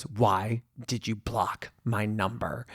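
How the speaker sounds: slow, deliberate mock-menacing question; imitating stalker caller. Stalker on the phone after being blocked, slow mock menace. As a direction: draw out each word like a creepy caller.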